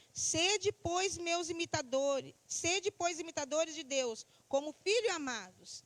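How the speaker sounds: loud, slow proclamation of scripture; declaimed Bible verse, drawn-out words; scripture reading. Acted. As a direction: read the verse out slowly, projected to the congregation.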